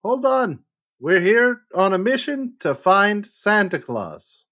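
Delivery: high loud call to halt Krampus; calling out to stop him. Acted. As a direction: raise both hands and halt the charging monster. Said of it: "I'd be like".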